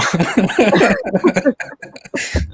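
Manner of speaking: high-pitched burst of laughter; real, helpless giggling; hearty laugh. Real, not acted. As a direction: crack up at a dark joke. Laughs at the sarcastic suggestion.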